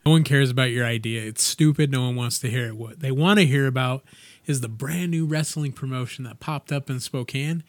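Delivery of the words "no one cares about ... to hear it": loud, fast dismissal; mock scolding. Cutting off his cohost's idea in a loud mock put-down. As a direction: shout him down for fun.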